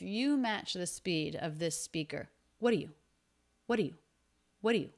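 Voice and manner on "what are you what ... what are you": What are you squeezed to whaddya, R dropped; rapid What are you with just a schwa for are; extremely quick. Shown as a demonstration. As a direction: fire off What are you as one very short burst. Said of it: "say it extremely quickly".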